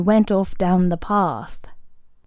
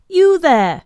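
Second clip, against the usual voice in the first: higher, louder call; raised voice; 8 semitones higher, 5 dB louder than usual. A narrator's reading. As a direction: call out to get his attention. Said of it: "raising his voice".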